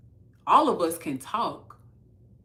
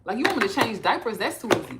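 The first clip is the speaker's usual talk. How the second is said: mock cocky; 4 semitones higher, 5 dB louder, faster than usual. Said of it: "Or, or".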